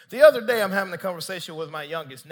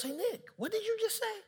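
high, soft put-on questioning voice; Holy Spirit voice; 13 semitones higher, 8 dB quieter than usual. Holy Spirit questioning Nick's words, high playful voice. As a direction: ask innocently, pitched way up and hushed. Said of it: "The Holy Spirit gonna start talking".

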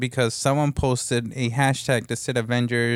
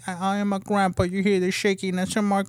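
put-on higher, shaky old grandpa; old man voice; 7 semitones higher than usual. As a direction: quaver like a frail old man. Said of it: "That was my old man voice".

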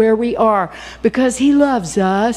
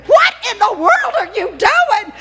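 much higher laughing yell; mother yelling; 11 semitones higher than usual. Retold or imitated. Mother finding daughter with phone in shower, laughing high shout. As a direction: shout it like a mother catching her child. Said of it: "And then I hear".

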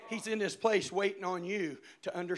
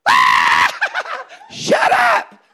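much higher, loud, laughing panicky yelp; scared little brother; 19 dB louder, much slower than usual. Retold or imitated. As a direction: imitate a terrified kid brother. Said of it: "And he goes".

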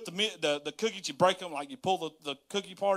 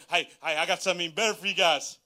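ordinary talk

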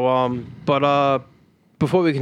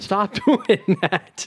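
slow, laughing stop doing that; laughing plea; 4 semitones higher, much slower than usual. Real, not acted. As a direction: beg through a laugh. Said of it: "laughing plea".

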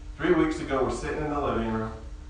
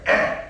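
put-on louder whiny baby grunt; baby fuss noise; 6 dB louder than usual. Dad imitating his baby wanting his pizza, a loud whiny grunt. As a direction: mimic the baby demanding pizza. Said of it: "I hear".

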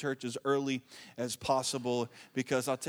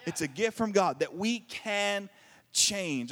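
slow solemn preaching; 3 semitones higher, much slower than usual. Acted.

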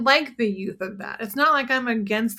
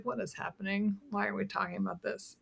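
quiet bemused thought; 13 dB quieter, faster than usual. Retold or imitated.

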